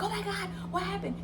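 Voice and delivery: high-pitched retold shock; panicked. Cousin seeing blood all over her face, panicked. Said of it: "she went into panic mode".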